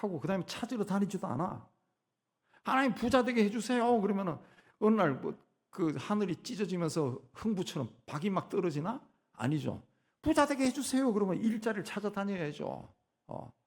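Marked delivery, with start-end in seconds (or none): 2.6-4.0 high-pitched, insistent acted plea; pleading prayer. Believer begs God to make them rich, pleading. 10.2-11.1 high-pitched, insistent acted plea; pleading prayer. Believer begs God to make them rich, pleading.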